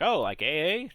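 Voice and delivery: loud, mocking "Oh, like A A?" in surprise; imitating people's surprised reaction loudly.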